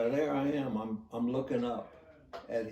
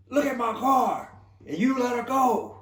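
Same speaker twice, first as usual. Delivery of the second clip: retold furious scream, much higher and louder; 14 semitones higher, 10 dB louder than usual. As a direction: yell it at the officer, enraged. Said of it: "he's screaming at me".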